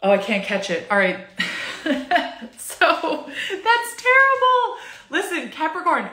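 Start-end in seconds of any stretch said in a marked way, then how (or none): 1.2-2.5 breathy unvoiced giggle; a breathy laugh at herself; airy laugh. Real, not acted. Laughs at failed yawn. 2.6-4.8 high, slow laugh-through words; real laughter breaking through the words. Real, not acted. Laughing at failed yawn. 5.1-6.1 laughing. Real, not acted. Laughing, addressing the listener.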